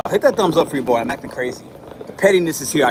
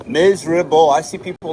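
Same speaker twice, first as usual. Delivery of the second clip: louder, wailing complaint; loud lament; 7 dB louder than usual. Real, not acted. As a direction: belt out the misery. Moping over crashed stream.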